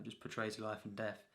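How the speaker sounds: usual speaking voice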